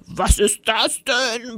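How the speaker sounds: slow, drawn-out indignant roar, held in volume; horrified bellow. A narrator's reading. Director finds the wrecked classroom, horrified drawn-out bellow. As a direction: bellow it aghast, stretching each word. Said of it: "brüllte er entsetzt".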